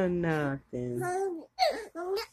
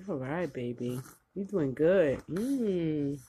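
tender; 6 semitones lower, 8 dB quieter than usual. Real, not acted. Soft talk to feeding baby.

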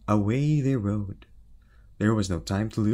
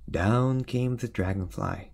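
ordinary delivery, nothing marked

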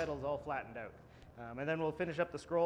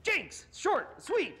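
imitating the bartender; 9 semitones higher than usual. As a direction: ham up the know-it-all bartender. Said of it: "he was like".

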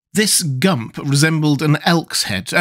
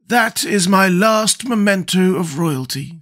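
slow, higher, relieved scarecrow; 7 semitones higher, slower than usual. Acted. Scarecrow giving up the crown, slow higher voice.

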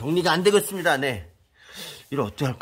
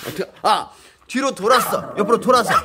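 laughing amazement; 6 semitones higher, 6 dB louder than usual. Real, not acted. Panda sneaks around barrier.

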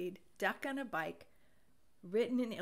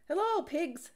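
higher, louder greeting; high hello; 8 semitones higher, 6 dB louder than usual. A narrator's reading. Duck greeting the pigs, higher and louder. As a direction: call out cheerily.

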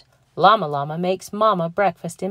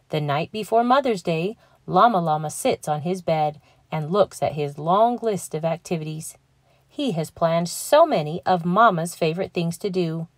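ordinary delivery, nothing marked

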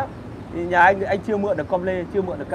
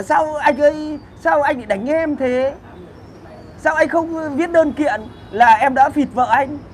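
drawn-out pleading voice, pitched up, slow; mocking whiny victim; 7 semitones higher, slower than usual. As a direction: mimic a simpering victim begging the man who beat him. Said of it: "Xong mày bảo là". (giọng nạn nhân rên rỉ giả vờ, kéo dài)

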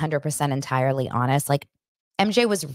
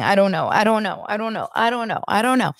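mock dithering; 4 semitones higher than usual. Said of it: "She's erasing".

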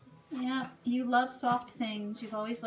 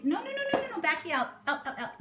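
much higher, rapid string of no's; quick protest; 6 semitones higher, faster than usual. Real, not acted. Owner stopping her mare from touching things, high quick no's. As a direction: stop the horse, high and urgent. Horse touching stuff in office.

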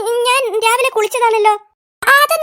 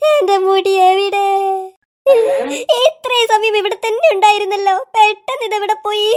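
hammed-up crying; 2 semitones lower than usual. Teacher wailing over her missing hair, hammed-up sobbing. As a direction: blubber it out like a comic weeping teacher. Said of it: "മുടി കാണായിട്ട് കരയുന്നതാ".

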